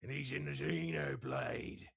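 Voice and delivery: put-on thick Australian accent. Voice actor showing off a character, thick Australian accent. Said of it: "very thick Australian accent".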